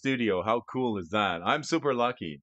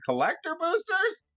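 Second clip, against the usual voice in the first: surprised. Real, not acted. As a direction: pitch jumps way up on boosters. Surprised by gift booster packs.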